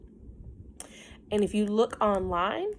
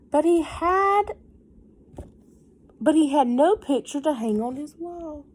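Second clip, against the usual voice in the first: higher, louder, stress on no; emphatic reading; 7 semitones higher, 8 dB louder than usual. A narrator's reading. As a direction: lean on no with regret. Reading to children.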